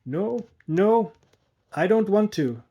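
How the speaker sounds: high, loud pleading refusal; frightened plea. A narrator's reading. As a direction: beg not to be made to do it. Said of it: "the poor woman began to cry".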